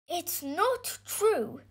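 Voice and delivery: put-on slow, high boy's voice; protesting boy. A narrator's reading. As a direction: deny it slowly and earnestly. Boy contradicts the villagers.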